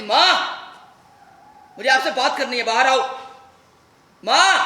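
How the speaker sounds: loud, high shout for mother; urgent, angry calling. Acted. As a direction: yell to summon her from outside. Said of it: "मैंने माँ को आवाज़ दी".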